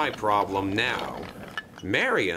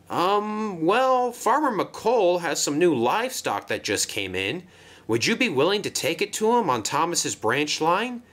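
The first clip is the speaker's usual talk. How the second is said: harried crane asking favour; 8 semitones higher than usual. Acted.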